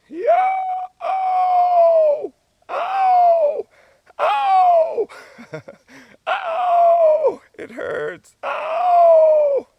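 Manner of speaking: very high, loud yelping cries; slow turkey yelp demo. Shown as a demonstration. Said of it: "if you were to do it slowly, you would go".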